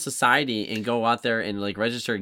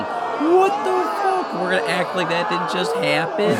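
high, incredulous mock outburst; outraged disbelief; 5 semitones higher than usual. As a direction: act out a freaked-out reaction, pitched way up. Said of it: "I like how Simon reacts".

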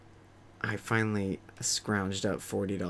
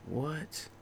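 breathy disbelief; 3 semitones higher, 10 dB quieter, much slower than usual. Retold or imitated. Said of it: "I'm like".

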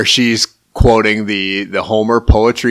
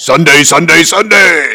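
high, loud hyped announcer; Sunday! Sunday! hammed-up promo call; monster truck ad; 8 semitones higher, 7 dB louder than usual. Friend hamming up an ad announcer's hyped call, high and loud.

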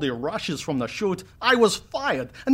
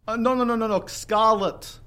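put-on accent coach correcting; 5 semitones higher than usual. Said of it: "master the accent".

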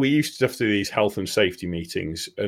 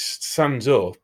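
ordinary talk, nothing marked

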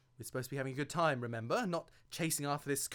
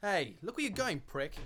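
loud, higher angry snap at a stranger; angry shout; 6 semitones higher, 4 dB louder than usual. Acted. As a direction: yell at him, offended. Stranger bumps him, spills drink.

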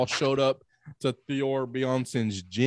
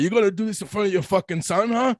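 higher-pitched retold threat; mock angry challenge; 8 semitones higher than usual. Thor confronting Eddie Hall in front of his son, mock angry. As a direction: challenge him, imitating an angry fighter.

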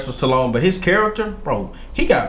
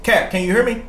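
radio call quote; 7 semitones higher than usual. Retold or imitated. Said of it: "you said".

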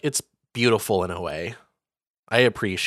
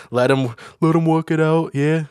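mock Liverpool voice, pitched up; 비틀스 흉내; 5 semitones higher, 4 dB louder than usual. Acting a Beatle about the rift.

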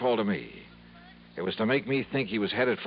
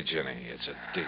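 ordinary talk

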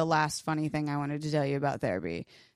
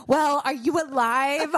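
higher, slowed parody of the check-in; mock the friend's bright-side line; mock cheery friend; 8 semitones higher, 4 dB louder, slower than usual. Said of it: "and you said".

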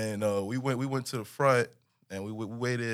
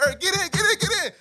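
high, loud hurry-up shout; urgent call; 3 dB louder than usual. Retold or imitated. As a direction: hustle everyone into the car. Friend urging them into the car.

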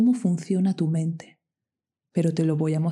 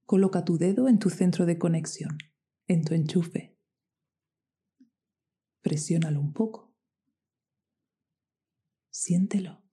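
guided meditation. Acted.

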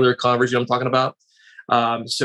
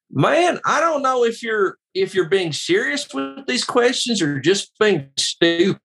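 higher, slow Southern twang; 8 semitones higher than usual. Retold or imitated. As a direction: drawl it out, exasperated. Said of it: "He's got a little bit of a twang".

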